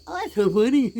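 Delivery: high, loud, gushing parody of her; mocking a ditzy girl who finds everything hilarious; mock giggly voice. Mocking the partner's silly purchases.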